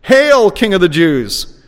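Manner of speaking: slow, high and scornful; mocking acclamation. A narrator's reading. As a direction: hail him loudly, with mockery. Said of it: "saying with mockery".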